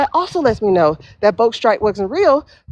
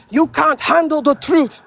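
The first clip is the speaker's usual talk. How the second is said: higher, slow movie-star line reading; Jack Nicholson impression; 6 semitones higher than usual. Quoting the movie moment, a slow hammed-up star delivery. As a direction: ham up a courtroom movie line, slowly. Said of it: "where Jack Nicholson is saying".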